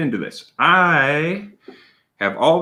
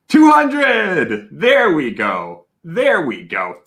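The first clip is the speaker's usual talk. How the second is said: much higher, louder cheer as the count hits the goal; excited cheer; 5 semitones higher, 4 dB louder, much slower than usual. Real, not acted. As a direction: celebrate the milestone out loud. Subscriber goal reached live.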